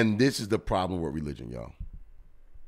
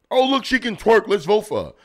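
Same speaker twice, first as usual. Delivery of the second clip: high, loud, airheaded voter voice; mock the fans who'd vote for a dancer; a hammed-up high voice of easily swayed voters; mocking gullible voters; 10 semitones higher, 5 dB louder than usual. Mocking voters who'd back a twerker.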